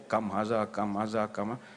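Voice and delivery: low soft rote repetition; muttered memorizing. Retold or imitated. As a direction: drill the phrase under your breath. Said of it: "Dia pun hafal lah".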